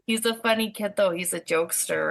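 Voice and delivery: ordinary talk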